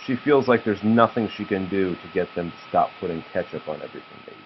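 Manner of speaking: ordinary delivery, nothing marked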